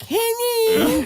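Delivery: high whiny mock echo of the wife's call; mocking whine. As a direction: parrot her back in a high whiny voice. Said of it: "I'll be like".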